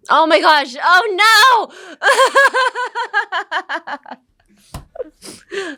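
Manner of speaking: loud, high shriek of dismay; real dismayed outburst. Real, not acted. Speaker catches herself saying 'um', laughing dismayed shout. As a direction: cry out, caught in your own slip, breaking into laughter.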